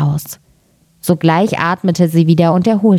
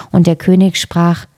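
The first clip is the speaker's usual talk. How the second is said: usual speaking voice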